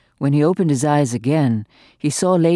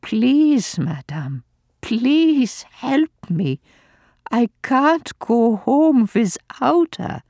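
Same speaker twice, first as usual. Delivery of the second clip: higher, slow, desperate plea; father pleading with the old lady, slow and desperate; 10 semitones higher, slower than usual. Acted. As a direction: beg her, drawing out please. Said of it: "Father rallied his last resources".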